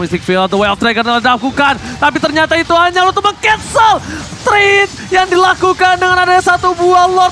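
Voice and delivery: shouted hype play-by-play, high and loud; fighting game announcer. Real, not acted. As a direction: scream the big play like a caster. Caster hyping decisive team fight.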